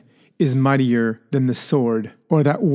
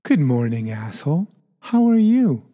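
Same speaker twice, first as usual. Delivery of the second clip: friendly-intent demo. Shown as a demonstration. Host showing intent changes an insult, friendly greeting. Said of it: "the intent behind them that is very powerful".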